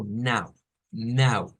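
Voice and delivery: "now" with a nasalized A; nasal não. Shown as a demonstration. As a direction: model "now" through the nose. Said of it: "pero nasal".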